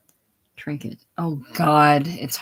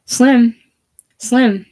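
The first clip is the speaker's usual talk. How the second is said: loud high call across the street; 7 semitones higher, 9 dB louder than usual. A narrator's reading. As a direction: shout the name to reach him. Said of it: "Trinket calling out".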